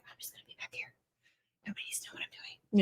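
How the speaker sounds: secretive little whisper of Kamala; mock whisper. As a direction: whisper it like hiding in the back. Kamala voiced hiding in back.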